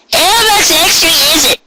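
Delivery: a chirpy country grandma; old Granny Smith voice. Acted. Granny Smith suspicious of price.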